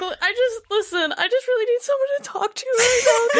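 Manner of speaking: high, tearful whiny plea; whiny crying plea. Retold or imitated. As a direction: whimper it like she's crying. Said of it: "she's just like".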